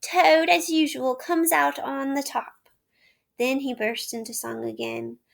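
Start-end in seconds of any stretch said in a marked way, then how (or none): 0.0-2.7 louder, slower gloating; boastful crowing. A narrator's reading. Toad crowing over his escape, gloating. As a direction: crow about himself. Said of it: "ecstasies of self-admiration".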